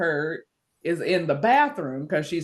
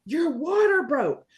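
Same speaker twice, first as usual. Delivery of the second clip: retold yelling; 9 semitones higher, 3 dB louder, slower than usual. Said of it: "She's yelling".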